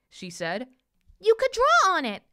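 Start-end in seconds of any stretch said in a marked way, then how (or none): 1.2-2.2 high, loud burst of an idea; excited princess. A narrator's reading. Princess offers her blank side to the boy, excited. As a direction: pipe up eagerly, pitched way up.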